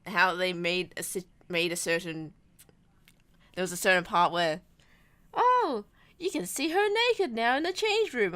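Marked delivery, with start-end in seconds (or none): 5.3-8.3 squeaky high, gushing mock tone; mock excited. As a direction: parody the film's cheap thrill, much higher. Mocking the movie's forced scene.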